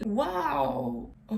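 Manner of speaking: cracked, raspy voice; rough voice just woken up; hoarse voice. Real, not acted. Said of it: "j'ai la voix cassée".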